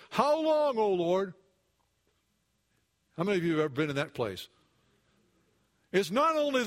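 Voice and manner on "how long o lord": high, pleading lament to God; anguished cry. Retold or imitated. Preacher voicing David's cry to God, pleading high. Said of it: "David did when he said".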